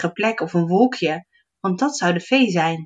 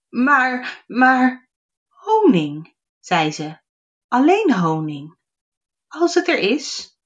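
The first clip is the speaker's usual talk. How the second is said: hesitant fairy; 6 semitones higher, slower than usual. Acted.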